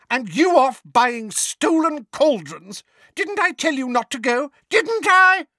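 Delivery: high, loud berating; furious scolding. Acted. Neighbour scolding Mundungus for deserting his post, furious. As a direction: build to a piercing final demand.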